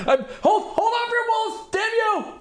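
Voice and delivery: high, frantic cry; a desperate high-pitched plea; panicked NPC. Acted. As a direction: shriek it out in panic.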